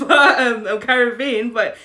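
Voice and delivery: amused, exasperated outburst with laughs; laughing exasperation. Real, not acted. Laughs at her own contradiction.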